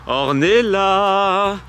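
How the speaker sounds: slow, high, loud call across the forest. Real, not acted. Calling hidden cousins in forest.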